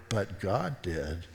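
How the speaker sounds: slow, quiet reveal after the denial; hushed punchline. Retold or imitated. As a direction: drop low and soft, very slow. Punchline of retold reply.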